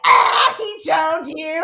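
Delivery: slow, high, loud laughing remark; friend laughing. Retold or imitated. Friend at customs laughing at the exchange, slow and high. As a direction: crack up while saying it. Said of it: "my friend's like".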